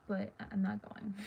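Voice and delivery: normal talking voice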